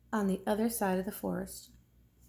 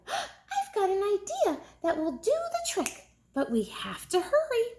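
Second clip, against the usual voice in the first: higher, quicker eager idea; excited cry; 10 semitones higher than usual. Acted. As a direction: burst out with the idea. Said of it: "Liza cried".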